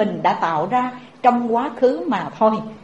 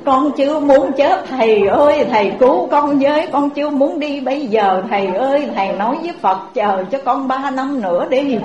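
desperate pleading; 2 semitones higher than usual. Acted. Terrified old woman begs monk.

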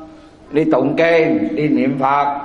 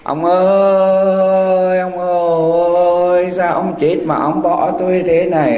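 mock funeral lament, sing-song and higher; wailing widow; 3 semitones higher than usual. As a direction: play a bereaved wife wailing theatrically at the graveside. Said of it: "bà khóc".

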